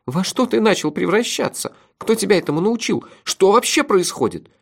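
high-pitched, alarmed questioning; trembling voice. A narrator's reading. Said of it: "дрожащим голосом спросил Мелифара". (встревоженно, дрожащим голосом)

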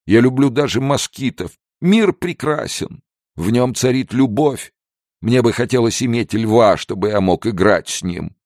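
put-on high-pitched, maudlin drunken rapture; tearful gushing. A narrator's reading. Drunk Pedro loves all God's creatures, tearful gushing. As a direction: gush with weepy love for every creature. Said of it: "проливая потоки слёз".

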